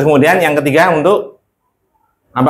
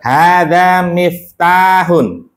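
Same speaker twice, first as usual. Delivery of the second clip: "Hada miftahun" slow, raised, falling to a close; model for repeating; 5 semitones higher, much slower than usual. Shown as a demonstration. Teacher gives the answer for students to repeat, slow model reading of "Hada miftahun". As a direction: stretch the long vowels in "Hada miftahun".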